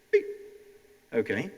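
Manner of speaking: normal talking voice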